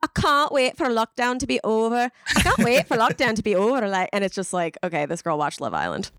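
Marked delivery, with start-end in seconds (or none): 0.0-2.1 put-on one UK accent. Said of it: "all these different U K accents". 2.4-3.9 put-on another UK accent. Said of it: "all these different U K accents".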